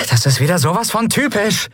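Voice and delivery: high, irritated outburst; exasperated high-pitched grumbling cry; cursing in exasperation. A narrator's reading. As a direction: curse your rotten luck. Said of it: "Fluchend versuchte Endrel".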